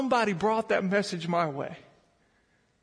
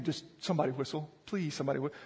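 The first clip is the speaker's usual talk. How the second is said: quiet pleading; 7 semitones lower, 9 dB quieter, faster than usual. Retold or imitated. Lost man pleading in swamp.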